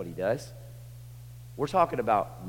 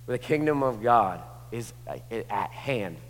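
ordinary talk, nothing marked

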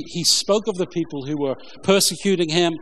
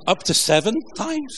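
higher, rising incredulous question; tentative question; 6 semitones higher than usual. A narrator's reading. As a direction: ask it tentatively, pitch climbing to the end. Peter's question to Jesus, read aloud.

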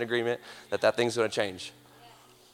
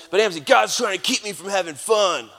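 whiny complainer; 8 semitones higher, 5 dB louder than usual. Retold or imitated. Voicing whiny congregant.

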